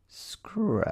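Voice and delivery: hushed, drawn-out creepy sound words; a spooky whispered scratch, scratch; eerie soft scratching. A narrator's reading. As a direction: breathe them out like something in the dark. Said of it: "It teetered off softly".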